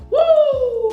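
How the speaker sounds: high joyful woo; excited whoop. Real, not acted. Unboxing gift, excited whoop. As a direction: let out a delighted whoop.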